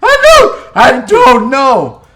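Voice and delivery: high, loud and slow faked distress; mock panicked Amy. Imitating Amy's fake panic on the call. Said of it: "she's like".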